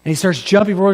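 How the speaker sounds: usual speaking voice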